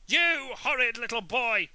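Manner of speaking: furious shout. A narrator's reading. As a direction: yell it, slowing on the last words. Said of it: "she shouted".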